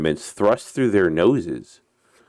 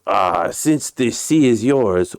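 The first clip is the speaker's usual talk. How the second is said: put-on Russian accent; 6 semitones higher, 3 dB louder than usual. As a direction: argue back in a Russian accent, pitch raised. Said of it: "uh, my Russian accent".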